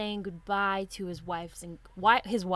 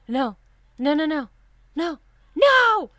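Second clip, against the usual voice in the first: imitated sleeper's cries building to a high yell; retold nightmare scream; 6 semitones higher, 9 dB louder than usual. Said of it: "And he screams".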